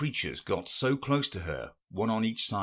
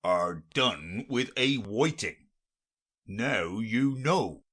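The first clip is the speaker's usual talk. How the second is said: slow, weighty creature voice; deep Gryphon voice; 3 semitones higher, 4 dB louder, slower than usual. A narrator's reading. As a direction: let it roll out slowly and gravely. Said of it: "in a deep voice".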